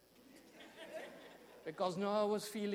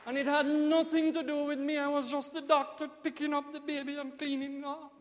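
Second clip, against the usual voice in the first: rising, louder preaching build; preaching climax; 5 semitones higher, 5 dB louder than usual. Real, not acted. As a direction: lift it to a ringing climax.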